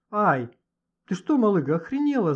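loud, high outraged yell; startled, indignant holler. Acted. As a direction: cry out, pitch shooting up. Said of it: "заорал Лёха".

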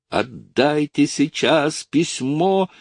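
high, drawn-out hysterical demand; hysterical cry. A narrator's reading. Lucy begs for her letter, hysterical. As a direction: stretch each word in hysteria. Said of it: "истерически крикнула она".

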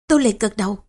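plain reading voice

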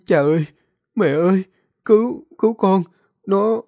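put-on high, loud desperate begging of a dying boy; weak pleading. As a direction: cry out for help to his parents in panic. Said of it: "thều thào nói như van xin". (con trai gọi cha mẹ cầu cứu, giọng cao hoảng loạn van xin)

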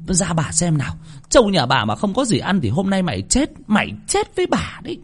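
high-pitched, furious village matriarch; angry scolding mistress. Acted. Mistress dragging the hunchback to the buffalo shed, scolding.

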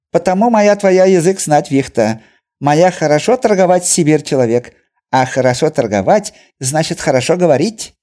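high, loud broken-Russian trader; Chinese merchant voice. A narrator's reading. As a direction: boast it eagerly.

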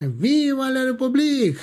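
very high, drawn-out rallying cry; battle cry. A narrator's reading. French column rallying, high battle cry. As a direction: cry the slogan high and long. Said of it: "раздался крик из колонны французов".

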